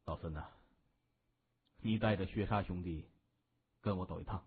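low, quiet, unhurried order; lightly voiced gang boss. A narrator's reading. Narrator voices boss giving orders. (略压低嗓音、平静下令的大哥)

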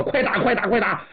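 rally the men to shoot now; urgent command. Acted. Commander orders firing. (急促地下令开火)

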